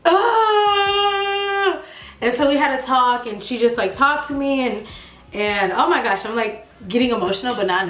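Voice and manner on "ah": a high, teary catch of breath; tearful gasp. Retold or imitated. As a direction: gasp as if about to cry. Reliving crying on the phone.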